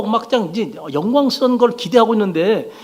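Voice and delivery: normal talking voice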